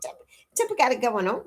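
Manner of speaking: quick, high, laughing praise; amused cheering. Real, not acted. Cheering on Temper as one of us, laughing. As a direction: rattle it off on the edge of laughter.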